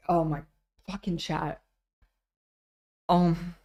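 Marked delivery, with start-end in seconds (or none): none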